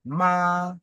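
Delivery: MA held high and level; constant first tone. Shown as a demonstration. Teacher showing students the constant first tone, high level MA. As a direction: sing out MA on one high, steady, long pitch. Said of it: "C'est constant".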